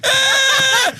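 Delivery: very high shouted wail; imitated wail. Scared boy crying in the back seat, very high wail. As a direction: let out a scared kid's cry. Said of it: "And my friend's going".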